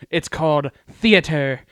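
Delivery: slow, loud theatrical declaration; little cousin's retort. Retold or imitated. Little cousin corrects the question about a play, slow and grandly. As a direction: draw it out like a little thespian. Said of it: "And he goes".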